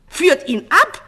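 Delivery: high, very loud command; screamed order. A narrator's reading. False king insisting to his guards, screaming. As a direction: shout the order with full force. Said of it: "schrie er abermals".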